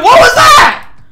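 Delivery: very high, loud yell; shocked outburst. Real, not acted. Streamer shocked by a move in the game, high outraged yell. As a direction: shriek the question in disbelief.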